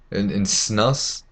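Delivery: ordinary talk, nothing marked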